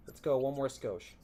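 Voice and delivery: ordinary delivery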